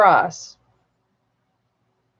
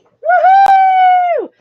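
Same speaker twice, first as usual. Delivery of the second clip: excited cheer; 7 dB louder than usual. Real, not acted. Cheering that buyers will pay.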